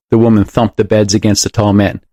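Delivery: ordinary delivery, nothing marked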